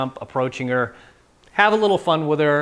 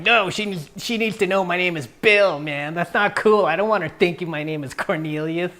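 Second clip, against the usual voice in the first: higher, whiny voice of a guy who takes himself seriously; mock uptight guy; 4 semitones higher than usual. Mocking the guy who insists his name is Bill, high whiny voice. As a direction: lightly mimic a stiff, offended guy.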